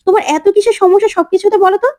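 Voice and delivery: a daughter losing patience with a nagging mother; exasperated aunt. Acted.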